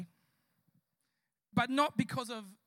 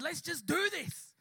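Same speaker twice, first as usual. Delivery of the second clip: slow, punchy rallying call; rallying resolve; 4 semitones higher, 3 dB louder, slower than usual. Retold or imitated. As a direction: stretch it out to spur the group on. Retold rallying before the prayer.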